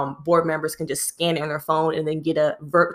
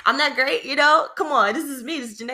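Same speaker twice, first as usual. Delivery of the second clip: higher, laughing hurt protest; 8 semitones higher than usual. Real, not acted. Telling friends about feedback, laughing hurt protest. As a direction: laugh at your own wounded pride. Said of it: "hurt pride, laughing".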